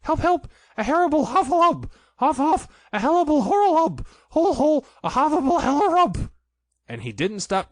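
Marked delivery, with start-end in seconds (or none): 0.0-6.3 high, loud panicked squeal; frightened cry for help. A narrator's reading. Piglet, fleeing the heffalump trap, shrieks in high panic. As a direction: shriek it like a terrified little pig. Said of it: "still crying out".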